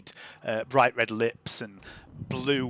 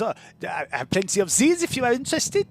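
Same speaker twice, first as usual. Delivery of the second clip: 1 shoe-selling woman; 9 semitones higher, faster than usual. Acted. GM voices a shoe seller.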